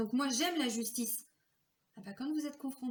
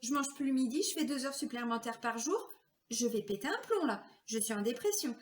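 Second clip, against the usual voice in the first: pleading complaint; 6 semitones higher than usual. Retold or imitated. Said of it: "je disais à mes patrons".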